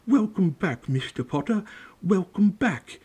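high-pitched gushing bartender; overcome welcome. A narrator's reading. Old bartender greets Harry with tears in his eyes, overcome. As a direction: welcome him back in a high, emotional voice.

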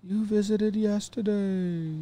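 high, soft, slow spooky ghost; haunting, drawn-out ghost voice. Pretend. Continuing the ghost bit.

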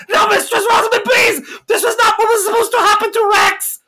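very high, loud crying scream; pitiful scream. Acted. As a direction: howl like a child taken away. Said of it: "most pitiful scream".

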